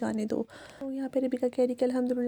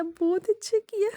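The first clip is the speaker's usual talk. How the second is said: much higher, drawn-out बहुत अच्छा किया; gushing praise; 9 semitones higher, slower than usual. Retold or imitated. Husband gushing over his wife's surprise, high and stretched. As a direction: squeal the praise up high and slow. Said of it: "ये मैं ऐसे बोल रही हूँ".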